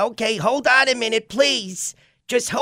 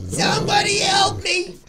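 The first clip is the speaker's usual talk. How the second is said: terrified horror victim; 5 semitones higher than usual. Acted. Screaming for help.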